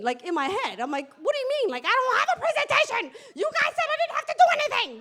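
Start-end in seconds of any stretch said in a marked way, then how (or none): 1.2-4.9 high, loud, rushed panicked squeal; freaking out. Retold or imitated. Speaker replays panic at being asked for a presentation, freaking out. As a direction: blurt it out in hammed-up panic, racing. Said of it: "I'm freaking out".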